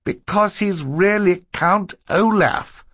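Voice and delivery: high, drawn-out exasperation; exasperated girl explaining the obvious. A narrator's reading. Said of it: "exasperated that she had to explain".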